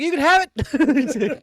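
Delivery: high, loud, slow squeal of surrender; hammed-up panic giving up the deer; mock terrified squeal. Hunter facing a bear, giving up in a mock terrified squeal.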